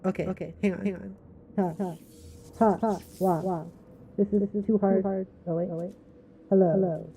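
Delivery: quiet, muffled and distant; through a wall. Acted. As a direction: test a voice behind a wall. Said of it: "It is actually a little hard to hear".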